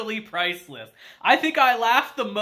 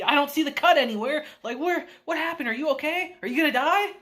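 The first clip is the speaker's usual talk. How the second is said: squeaky worried idiot hero; frantic dimwit; 8 semitones higher, faster than usual. Retold or imitated. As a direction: play the clueless hero in a fluster, pitched way up. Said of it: "starts lifting up the shirt, trying to see".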